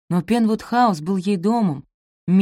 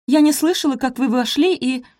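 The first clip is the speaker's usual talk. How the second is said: flustered; 8 semitones higher, 4 dB louder than usual. A narrator's reading. Startled maid explains to intruding mistress.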